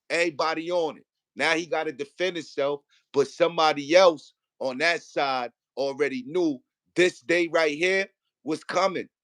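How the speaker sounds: ordinary delivery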